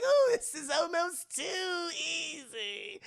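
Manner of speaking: high, slow singsong gloating; hammed-up crowing clown; Joker. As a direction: stretch it out with villainous glee. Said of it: "Joker is like".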